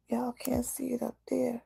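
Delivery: breathless unvoiced whisper. Real, not acted. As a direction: mutter it as a hushed aside. Aside about her wound.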